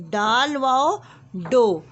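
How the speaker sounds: slow, loud, stretched letter-by-letter reading; phonics drill. Shown as a demonstration. Teacher drilling KG class on joining letters with वाव. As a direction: sound out each letter pair for small children. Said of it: "हमारी आवाज़ के साथ हम पढ़ेंगे".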